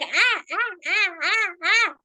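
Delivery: put-on loud high startled "ah" cries from a child; boy's scared yelps. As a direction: yelp like a frightened boy. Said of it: "The boy was like".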